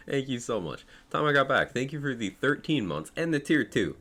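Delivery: groggy morning voice; just woke up, voice still rough. Real, not acted. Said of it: "pardon the, the morning voice".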